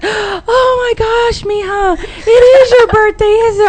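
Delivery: gasp, then wail it high like a mortified mother; mother's shocked voice. Retold or imitated. Voicing mom's shock at forgetting.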